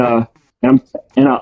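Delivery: normal talking voice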